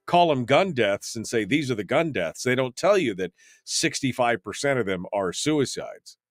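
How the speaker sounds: normal talking voice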